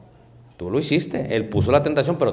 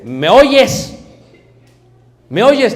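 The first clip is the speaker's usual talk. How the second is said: higher, louder insistent wife; imitating nagging wife; 9 semitones higher, 10 dB louder, much slower than usual. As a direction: call out to the distracted husband, demanding. Wife demanding attention.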